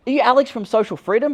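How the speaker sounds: high, loud Aussie bloke calling out; excited fan voice. Retold or imitated. A fan recognizes him on the beach, high loud excited call. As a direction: play the eager fan who spotted him. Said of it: "he's like".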